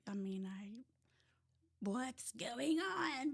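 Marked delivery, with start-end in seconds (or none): 1.8-3.3 drawn-out, higher plea of disbelief; mildly acted exasperation; retold bewildered protest. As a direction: stretch it out, raised and indignant. Retells her angry bewilderment at news.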